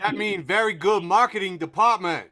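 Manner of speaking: loud, high fake accent through laughter; hammed-up broken-English accent, laughing; mock foreign accent. Mocking a marketing department in a bad fake accent. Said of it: "That was racist".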